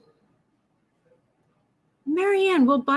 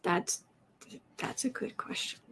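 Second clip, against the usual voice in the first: whisper; 3 semitones lower, 12 dB quieter than usual. Real, not acted. Musing quietly to herself.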